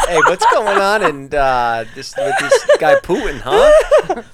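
laughing yes-man impression, rising high and loud; Jason Nash impression. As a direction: giggle it out, pitch climbing to a squeal on huh. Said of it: "That's how he does it".

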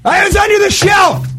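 high, loud playful yell; mock shout from hiding. Friend calling out from under the shelf, high playful shout. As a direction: shout out like you popped from a hiding spot.

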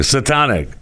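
"Satanic" said as suh-TAH-nik; mispronounced. Real, not acted. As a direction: open ah in the middle of "Satanic". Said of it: "I said it wrong again".

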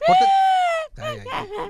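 very high, slow yell; high shout. Real, not acted. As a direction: call out sharply and high. Calling a cohost to order.

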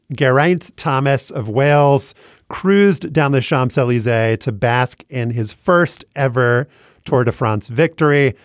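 normal talking voice

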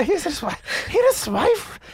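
high-pitched playful Irish accent; Irish accent attempt. Shown as a demonstration. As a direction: try the Irish lilt high up. Replays his accidental Irish slip.

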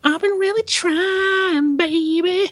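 slow crooning falsetto; [name]. Retold or imitated. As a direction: croon it slow and high like soul. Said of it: "even some [name]".

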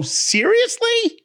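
"Seriously?" very high, stretched and falling; shocked outrage. Acted. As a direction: drag "Seriously?" up high then let it fall. Mock outrage over snack.

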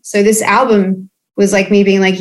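usual speaking voice

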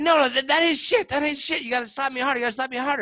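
high, insistent retold goading; mimicking a demanding lover; worked-up demand. Acting the demanding girl mid-quarrel.

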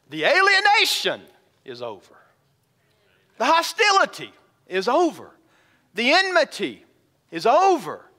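high, loud ringing proclamation; triumphant pulpit cry; preaching crescendo. Real, not acted. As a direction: build and soar on each phrase. Preaching climax.

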